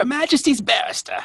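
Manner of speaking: high, put-on British voice; plummy courtroom British; mock British.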